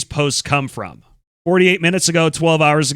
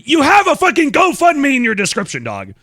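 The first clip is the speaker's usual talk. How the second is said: higher-pitched, indignant call-out; exasperated outburst; 8 semitones higher than usual. Real, not acted. Streamer rebutting the claim of not asking for money, exasperated. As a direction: burst in with heated disbelief.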